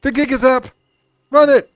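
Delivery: high, loud shout; urgent alarm call. Acted. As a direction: yell a warning to flee. Calling out as the plan fails.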